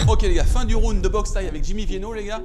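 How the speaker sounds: hyped-up sponsor read. Acted. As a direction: pitch the product to viewers.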